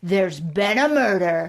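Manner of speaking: slow, ominous mock reveal; dramatic announcement. Michael announcing to the staff, mock ominous. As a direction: drop it like a thriller line. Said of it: "closes his phone and he's like".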